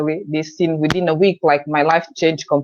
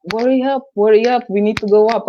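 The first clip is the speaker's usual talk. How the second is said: impatient friends; 5 semitones higher than usual. Retold or imitated. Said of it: "my friends were like".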